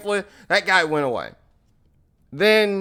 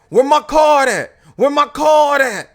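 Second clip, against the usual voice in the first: loud, higher-pitched repeated demand; mock furious yell; mad; 6 semitones higher, 6 dB louder than usual. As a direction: act out an angry confrontation. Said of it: "He's mad".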